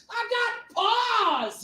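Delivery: high, strained mock yell; retold shouting. Man being thrown out into the parking lot, mock shouting. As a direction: holler it as if being dragged away. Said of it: "I just kept shouting".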